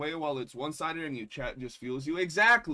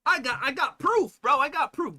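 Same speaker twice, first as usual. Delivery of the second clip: higher mock hype; squeaky excited gotcha voice; hyped-up internet accuser; mock eager accuser; 9 semitones higher, 5 dB louder than usual. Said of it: "These folks be like".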